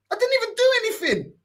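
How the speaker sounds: high, pleading innocence; high protest. Retold or imitated. Voicing his own protest.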